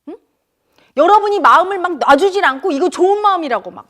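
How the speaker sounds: usual speaking voice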